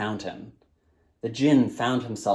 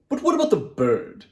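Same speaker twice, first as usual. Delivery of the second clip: djinn voice; 6 semitones higher, 4 dB louder than usual. A narrator's reading. Djinn asks.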